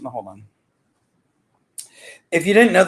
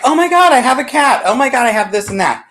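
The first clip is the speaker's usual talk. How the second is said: excited; 8 semitones higher, faster than usual. Retold or imitated. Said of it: "I'm like".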